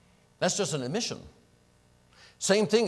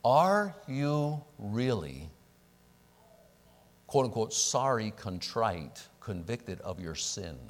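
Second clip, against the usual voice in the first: drawn-out, very slow are you really; slow right down to press the point; deliberately stretched question; slow emphasis; 5 semitones lower, 3 dB quieter, slower than usual. Real, not acted. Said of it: "listen, listen".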